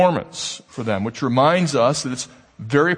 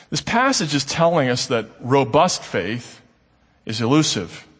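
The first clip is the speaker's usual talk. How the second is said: ordinary talk, nothing marked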